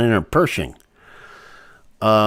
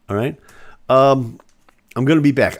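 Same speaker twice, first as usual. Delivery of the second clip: ordinary talk, nothing marked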